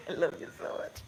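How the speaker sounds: tender whisper. Real, not acted. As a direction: whisper lovingly. Whispering to baby.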